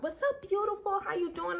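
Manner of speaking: high, soft mock charmer; lightly mock his smooth greeting; imitating the man calling her beautiful; imitating smooth guy. Voicing the man on phone.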